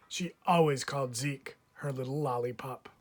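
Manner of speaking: ordinary delivery, nothing marked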